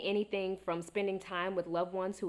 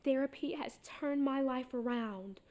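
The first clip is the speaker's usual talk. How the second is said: lightly mimicked devotee, slow and higher; gushing therapy convert; 6 semitones higher than usual. Quoting New York friends who praise therapy, lightly mimicked testimonial. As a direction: stretch it out like a mild testimonial. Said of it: "they were all like".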